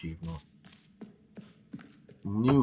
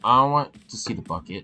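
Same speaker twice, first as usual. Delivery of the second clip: eager; 4 semitones higher, 8 dB louder than usual. Real, not acted. Eager loud demand.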